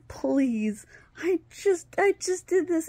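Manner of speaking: high-pitched, pleading whine; retold begging. Injured stepparent to the fighting kids, begging. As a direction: climb high as you plead. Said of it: "I'm begging them".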